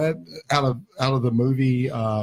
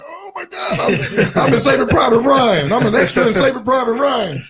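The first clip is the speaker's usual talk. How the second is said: mock excited squeal; 11 semitones higher than usual. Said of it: "I'd have been like".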